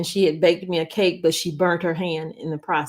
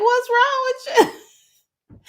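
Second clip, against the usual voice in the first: high teasing quote; 4 dB louder than usual. Retold or imitated. Family asking why she's crying, high teasing voice. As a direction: squeak it out, amused. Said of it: "it was like".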